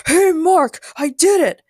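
loud, high-pitched triumphant call; excited shout. A narrator's reading. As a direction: shout it out to him. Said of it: "spotted Stephen and shouted".

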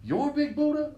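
high surprised squeal of the name; mock disbelief. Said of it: "He goes".

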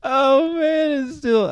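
high laugh spilling into the words; helpless laugh at your own pun; real laughter. Real, not acted. Comic retelling his best joke, laughing high and real.

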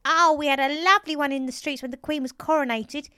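put-on high, loud reminiscing old lady; June. A narrator's reading. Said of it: "She smiled her toothless smile".

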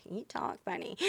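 near-whispered imitation of a comment; soft, breath-only aside; hushed mock remark. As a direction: drop to a confiding whisper for the quote. Quoting someone's remark on her accent.

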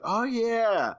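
high, drawn-out realization; retold moment of remembering; sudden recollection. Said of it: "remember, like".

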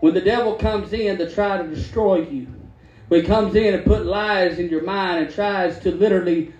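ordinary delivery

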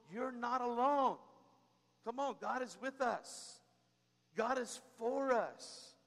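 slowed, stressed preaching beat; slow emphatic assurance. Acted. Pastor assuring the congregation, slow and firm. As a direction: land each word slowly.

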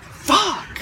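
mock yelp after hitting the wall; stubbed toe. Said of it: "I was like".

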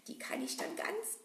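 gloating whisper of a villain; whispered scheming; sly whisper. Acted. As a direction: hiss it out, pleased with the plan. Villain gloating over stolen eggs.

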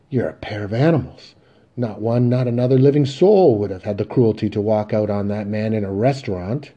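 loud, outraged condemnation; angry denunciation. Acted. Mother to sons who walked out on their father, outraged.

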